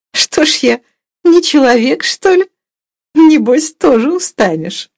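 high, lively woman's retort; slightly flustered mistress. A narrator's reading. Blushing mistress answers Sergei's flattery, lively and high. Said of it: "ответила слегка краснея".

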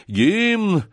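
high, hesitant call from afar; lone uncertain cry. Acted. A lone voice far off in the tavern, uncertain cry. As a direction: call it out alone, unsure. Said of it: "крикнул вдали одинокий, неуверенный голос".

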